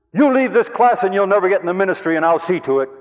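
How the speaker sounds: loud, high, warning threat; angry professor threat. Retold or imitated. Professor threatening a quitting student, loud angry warning. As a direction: hold the ministry over his head.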